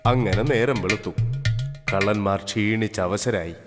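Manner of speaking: usual speaking voice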